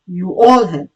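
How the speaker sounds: ordinary talk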